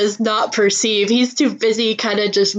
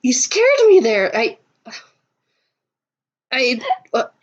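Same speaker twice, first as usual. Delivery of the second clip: flustered, higher-pitched stammering; startled stammer; 3 semitones higher than usual. Acted. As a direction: stammer like you just got spooked. Acting startled Sammy after being scared.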